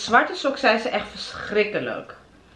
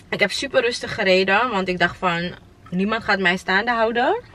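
ordinary delivery

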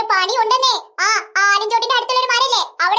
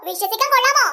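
normal talking voice

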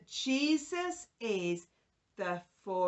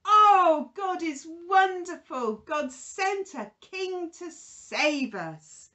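excited praise; 5 semitones higher, 7 dB louder, slower than usual. Retold or imitated. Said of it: "with everyone saying".

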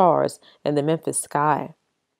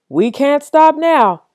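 loud, higher, slow holler; old man hollering; 9 semitones higher, 7 dB louder, slower than usual. Acted. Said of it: "An old man hollered".

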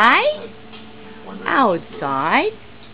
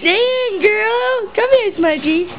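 excited call to a dog; 12 semitones higher, 3 dB louder, slower than usual. Real, not acted. Calling the other dog excitedly.

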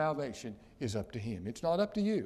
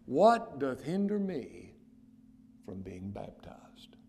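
hushed plea; slower than usual. Retold or imitated. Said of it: "that eunuch said".

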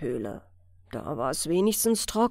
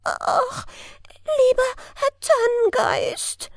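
very high, tearful cry for mercy; sobbing plea; 14 semitones higher, 3 dB louder than usual. A narrator's reading. Elf interrupting fir spirit, pleading high and sobbing. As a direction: beg through sobs. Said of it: "fiel das Elfchen ihm schluchzend ins Wort".

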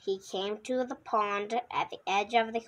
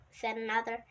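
usual speaking voice

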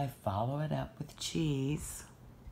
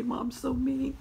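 higher, sulky puppy complaint; dog's sulky voice; 10 semitones higher, 3 dB louder than usual. Pretend. Mom speaks for the sad puppy, higher and sulky. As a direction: pout it as the poor little boy. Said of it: "This poor little boy".

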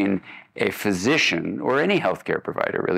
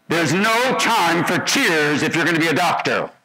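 much higher and louder, a stern cold scolding; harsh attending's rebuke; 9 semitones higher, 4 dB louder than usual. Retold or imitated. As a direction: bark it down at the crying student like a hard senior doctor. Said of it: "I'm actually toning it down".

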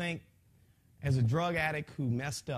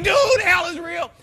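high, loud pleading shout; frantic urgent shout; 12 semitones higher, 15 dB louder than usual. Retold or imitated. Man telling the paramedic about hell, frantic shout. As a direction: insist with all your force. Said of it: "I'm like".

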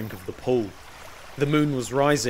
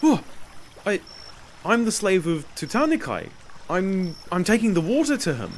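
higher, halting, nervous slave; startled; 6 semitones higher than usual. A narrator's reading. Slave answers a voice from the darkness, startled. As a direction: stammer it out, caught off guard. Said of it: "started at the voice coming from the darkness".

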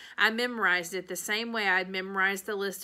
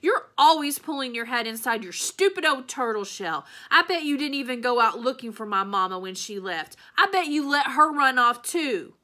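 loud, higher, furious accusations; angry shouting; 5 semitones higher, 8 dB louder than usual. A narrator's reading. Opal blaming her father for her mama leaving, furious shouting. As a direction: keep hurling it at him, rising to the end. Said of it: "I shouted".

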